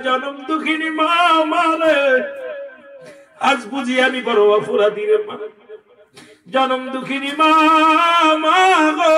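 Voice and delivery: high sung lament to a mother; acted wailing cry. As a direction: call for mother in a melodic, hammed-up wail. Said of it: "একটা চিৎকার মেরে দিয়ে কয়". (করুণ সুরে মাকে ডেকে বিলাপ)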